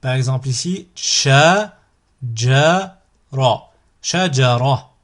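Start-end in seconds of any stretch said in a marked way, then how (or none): 1.0-3.7 slow, each syllable drawn out separately; split into syllables. Shown as a demonstration. Teacher models a word for learners to repeat, split into syllables. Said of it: "vous découpez le mot". 4.0-4.9 syllables joined as one word. Shown as a demonstration. Teacher models a word for learners to repeat, joined in one go. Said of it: "vous le prononcez d'un coup".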